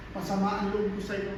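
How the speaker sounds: low, soft admission of a grudge; quiet resentment. Acted. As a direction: confess the hurt under your breath, holding it back. Acted confession of resentment, held back. (mahinang pag-amin ng hinanakit)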